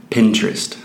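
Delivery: Pinterest in American accent. Shown as a demonstration. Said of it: "Eu vou falar com o sotaque americano".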